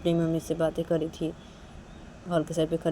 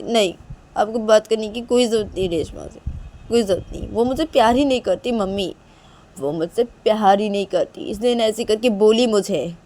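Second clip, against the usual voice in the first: raised, emphatic complaint; upset, angry daughter; 4 semitones higher, 8 dB louder than usual. Acted. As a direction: protest hotly to mother, pushing key words louder. (नाराज़ बेटी की तेज़, ज़ोरदार शिकायत)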